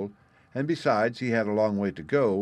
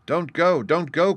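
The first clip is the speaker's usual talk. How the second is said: higher, louder pleading call; urgent cry; 8 semitones higher, 7 dB louder than usual. A narrator's reading. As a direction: cry out a warning to stop someone. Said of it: "cried the boy".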